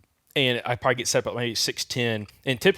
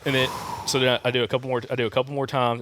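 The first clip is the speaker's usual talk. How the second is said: usual speaking voice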